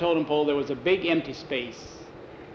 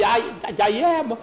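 scared; 7 semitones higher than usual. Acted. Jackie frightened by the natives.

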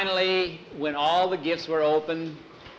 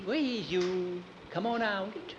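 put-on coaxing boy; 5 semitones higher, 6 dB quieter, slower than usual. Skeeter coaxing frog from pond.

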